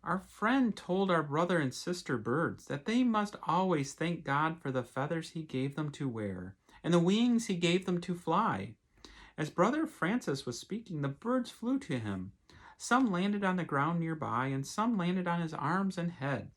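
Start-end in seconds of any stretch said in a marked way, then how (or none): none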